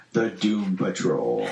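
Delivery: slow mock-dramatic title reveal; hammed-up announcer flourish; grand announcement. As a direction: draw the name out like a big reveal. Acts out boss unveiling trademark name.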